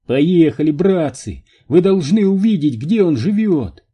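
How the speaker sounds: rally the group to get moving; eager rallying call. A narrator's reading. Энди зовёт всех ехать. (задорный призыв)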